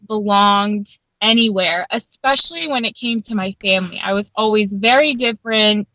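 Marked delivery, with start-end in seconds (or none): none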